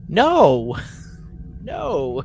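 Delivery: normal talking voice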